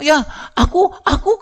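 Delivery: put-on high, eager little kid calling out; eager child voice. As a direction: pipe up like a kindergartner who wants to be picked. Child eager to answer teacher.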